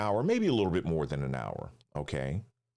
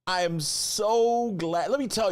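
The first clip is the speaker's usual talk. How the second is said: excited; 12 semitones higher, 4 dB louder than usual. Real, not acted. Host gushing about stream.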